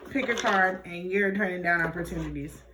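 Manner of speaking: ordinary talk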